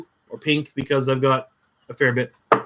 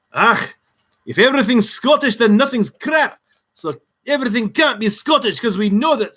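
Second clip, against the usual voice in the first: high, lilting mock Scottish accent; bad Scottish accent; 9 semitones higher than usual. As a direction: put on a hammy Scottish accent. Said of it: "how offensive my Scottish accent is".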